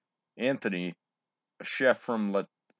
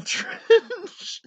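laughing; 7 dB louder than usual. Real, not acted. Laughs mid punchline.

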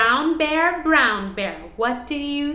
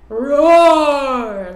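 slow, louder roar; 9 dB louder than usual. Acted. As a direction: roar it like a lion. Lion roar during recap.

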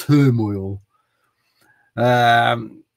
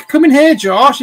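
loud, high call down the hall; 10 semitones higher, 10 dB louder than usual. Real, not acted. Dad calls son Josh in to face him, loud high call. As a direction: shout for him to come in.

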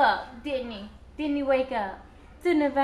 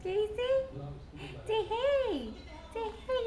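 high, soft coaxing coo; 7 semitones higher, 6 dB quieter than usual. Real, not acted. Mother coaxing her baby to say hey, high soft coo. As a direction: coax him up high and soft.

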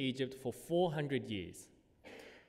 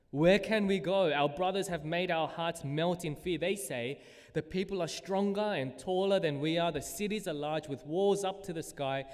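higher, louder acted complaint; grumbling; 5 semitones higher, 4 dB louder than usual. Preacher reads Israelites grumbling about the stronger people, in protest. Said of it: "You see, they're grumbling".

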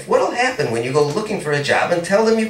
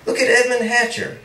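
ordinary delivery, nothing marked

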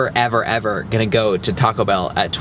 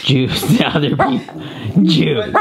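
laughing; 4 semitones higher, much slower than usual. Real, not acted. Laughing at the growling dog.

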